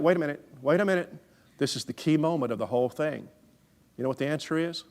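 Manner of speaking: normal talking voice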